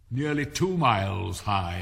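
loud slow imperious queen. Acted. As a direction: boom it out like a queen.

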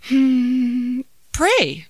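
put-on high, loud childlike answer; ponder, then pop out the answer confidently. Daughter answers mother's question this time, confident. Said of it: "this time with confidence".

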